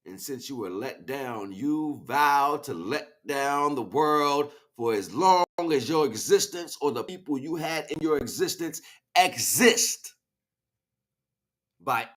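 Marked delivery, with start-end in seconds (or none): none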